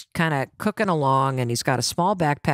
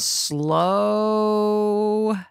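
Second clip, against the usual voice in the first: drawn-out SLOW; 3 semitones higher than usual. Shown as a demonstration. Family huddle slowing the pace, drawn-out "slow". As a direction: stretch "slow" into one long held vowel.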